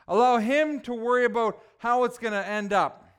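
preaching with fervor. Acted. Preaching the main point.

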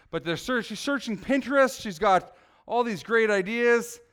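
ordinary delivery

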